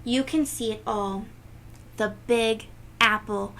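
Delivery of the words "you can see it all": ordinary delivery, nothing marked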